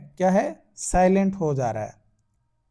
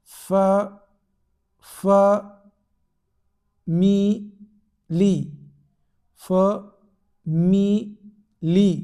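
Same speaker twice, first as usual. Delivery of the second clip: फ, मी, ली as isolated beats with long pauses; slow syllable demo; 3 dB louder, much slower than usual. Shown as a demonstration. Teacher showing the chin-drop method, slow syllable demo. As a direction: say each syllable of फ मी ली slowly and separately. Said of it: "word जो है धीरे धीरे बोलना है".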